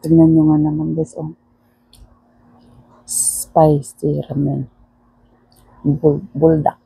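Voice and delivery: normal talking voice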